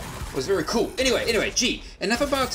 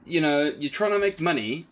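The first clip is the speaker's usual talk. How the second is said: ordinary delivery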